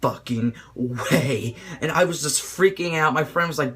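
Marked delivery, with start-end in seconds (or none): 0.0-1.6 slow, low, drawn-out disbelief; mock awestruck no way; stunned disbelief. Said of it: "I was like".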